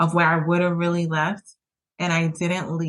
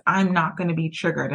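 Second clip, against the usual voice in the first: ordinary talk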